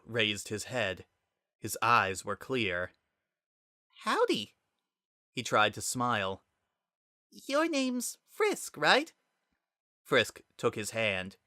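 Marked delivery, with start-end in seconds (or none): 4.0-9.1 timid Azriel. Acted. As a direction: greet him shyly with a small smile. Azriel waking, trying to smile.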